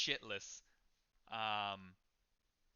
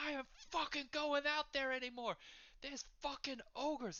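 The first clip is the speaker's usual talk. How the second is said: high-pitched, worked-up patron; strained high character voice; angry rant. Acted. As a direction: rant in high, exasperated outrage. Patron rants about going out.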